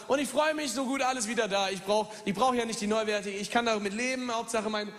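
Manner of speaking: normal talking voice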